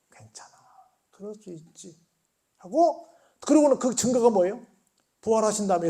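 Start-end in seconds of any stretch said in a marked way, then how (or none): 0.1-2.0 much softer, tender forgiveness; gentle reassurance. Retold or imitated. Jesus quietly forgiving Peter, soft and tender. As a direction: barely breathe the comfort. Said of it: "예수님은 그렇게 안 보셨다는 거라".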